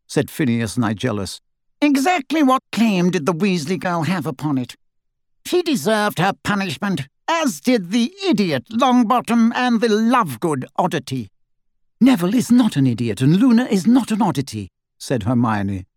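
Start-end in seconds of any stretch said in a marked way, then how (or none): 1.8-11.3 high, haughty disdain; pompous, pitched-up contempt; snide grandee, scornful. A narrator's reading. Snide portrait Phineas scorns pupils. 12.0-14.7 higher, emphatic retort; pitched-up indignation; indignant defence. A narrator's reading. Hermione indignantly defends her friends.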